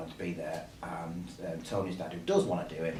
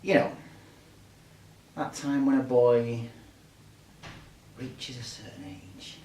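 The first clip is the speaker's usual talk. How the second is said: bad acting; 3 semitones higher, 5 dB louder than usual. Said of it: "Don't worry, excuse mine".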